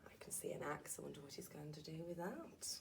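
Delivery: hushed aside, barely voiced; whisper. Real, not acted. Whispering to the child beside her about the axe. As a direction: lean in and whisper it. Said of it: "whispering aside to listener".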